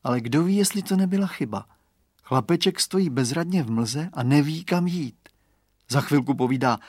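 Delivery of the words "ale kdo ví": usual speaking voice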